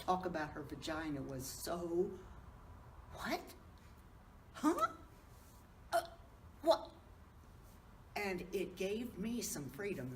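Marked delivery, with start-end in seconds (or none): 3.1-6.9 high, startled little questions with long gaps; mock bafflement, one halting word at a time; stunned reaction. Older woman recalls hearing vagina talk on stage, stunned.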